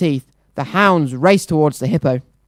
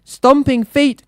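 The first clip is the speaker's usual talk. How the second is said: high, slow frightened cry; 9 semitones higher, slower than usual. Acted. Townspeople crying out in fear of the hippo. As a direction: cry it out in panic. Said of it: "cried the townspeople".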